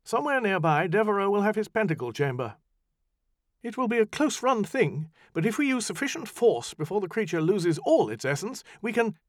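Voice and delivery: high, tense plotter racing the clock; urgent magician. Acted. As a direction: press the plan on fast and hard.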